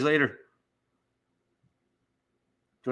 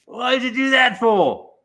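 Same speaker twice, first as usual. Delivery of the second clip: high, aggrieved Aussie kangaroo; put-on Australian accent, high-pitched; 10 semitones higher, 5 dB louder, slower than usual. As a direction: protest like an indignant Australian, pitched well up. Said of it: "The kangaroo's like".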